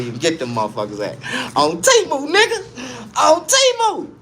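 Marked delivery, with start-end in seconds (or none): 1.6-4.1 hyped shout. Real, not acted. Hyping the shop.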